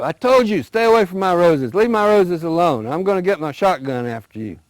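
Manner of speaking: high loud shout, easing at the threat; furious yell. Acted. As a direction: scream the warning. Old woman yelling, threatening.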